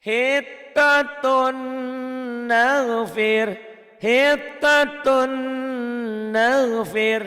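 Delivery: hitatun nafir chanted very slowly, high and loud, doubled consonants held; loud, slow model of hitatun nafir; doubled letters held. Shown as a demonstration. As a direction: recite HITATUN NAFIR long-drawn, leaning on the double T and N. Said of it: "ada tasdid juga".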